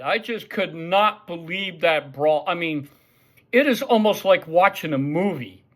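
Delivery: ordinary talk, nothing marked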